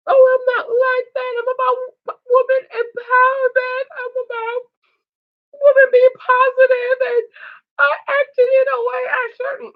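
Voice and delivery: high whiny mock sob; mock crying Nina. Mocking a crying contestant to viewers in a high whiny voice. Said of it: "cue the tears, 'cause of course she's gonna cry".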